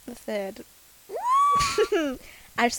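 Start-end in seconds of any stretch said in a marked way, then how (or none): 1.1-2.3 shrieking laugh. Real, not acted. Laughing at joke.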